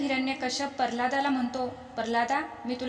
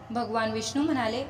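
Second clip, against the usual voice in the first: usual speaking voice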